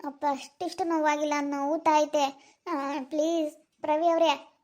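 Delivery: soft, pained complaining about a hurt leg. Acted. Woman with a hurt leg pleading with Pravi, soft and pained. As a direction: talk quietly, slowing near the end as if it hurts to move. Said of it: "ನನ್ಗೂ ಕಾಲ್ ನೋವಾಯ್ತಪ್ಪ".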